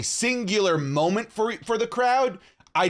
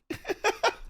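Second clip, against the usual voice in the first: high breathy laugh; 5 dB quieter than usual. Real, not acted. Laughing at own accent.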